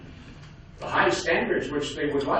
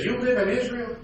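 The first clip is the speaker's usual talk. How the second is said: put-on higher-pitched Yiddish-accented cabbie; 10 semitones higher than usual. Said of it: "he talked with a heavy Yiddish accent".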